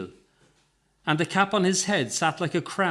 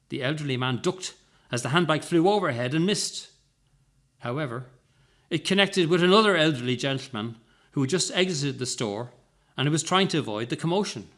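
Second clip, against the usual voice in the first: plain reading voice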